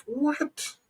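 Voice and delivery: high, soft, rising question; acted high-pitched disbelief; baffled what. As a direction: squeak out a quiet, puzzled what. Acts out baffled listener.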